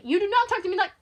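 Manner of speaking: loud, high outburst; enraged bully, cut off mid-sentence; furious. Acted. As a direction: shout the rebuke, outraged. Caller furious at being dismissed.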